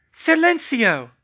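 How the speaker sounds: high, loud incantation; spell cast. Acted. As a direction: fling the spell out sharply. Casting a spell.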